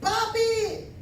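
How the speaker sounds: put-on high-pitched little girl calling out; imitated child's yell. Little daughter calling her dad from bed, imitated high cry. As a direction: call for daddy in a high, drawn-out child's voice. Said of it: "me empieza a gritar".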